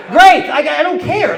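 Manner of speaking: loud, high sarcastic outburst; hammed-up irritation; exasperated retort. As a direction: throw it back, fed up and shouting. Acts out annoyed reply to driver.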